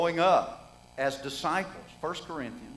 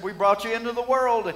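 careless parents; 4 semitones higher, 6 dB louder than usual. Retold or imitated. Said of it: "The parents said".

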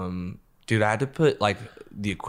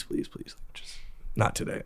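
whispered, softer plea; pleading whisper; 11 dB quieter than usual. Retold or imitated. Security guard quietly begging him to drop it, whispered.

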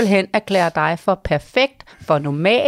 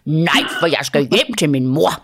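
firm refusal; 3 semitones lower than usual. Retold or imitated. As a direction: say it firmly and clearly, as if [name] is showing she can. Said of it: "Må jeg høre, hvor bestemt du kan være". (bestemt afvisning, som et barn der øver sig)

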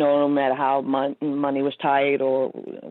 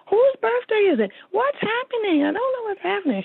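high, distressed, confused questions; imitating upset mom. Mother upset and confused at her birthday party, high distressed questions. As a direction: voice her mom's panicked confusion. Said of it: "my mom got really upset".

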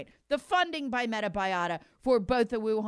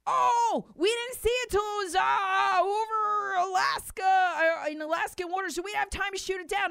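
hammed-up flustered excuse, higher and shouted; mock official excuse; 7 semitones higher than usual. Mocking NORAD excuse, shouted.

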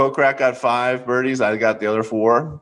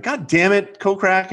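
mock frustration; 10 semitones higher, slower than usual. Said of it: "I'm like".

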